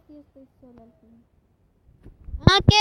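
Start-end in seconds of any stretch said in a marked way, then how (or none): 2.4-2.7 high, loud startled cry; loud, high-pitched shocked cry; shocked yelp. Acted. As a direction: jump at the surprise, loudly. Sudden shock in story.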